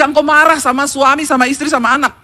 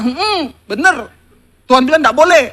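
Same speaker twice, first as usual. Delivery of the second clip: smug mock answer; 4 dB louder than usual. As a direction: savour the answer like a small victory. Retold triumphant answer to husband.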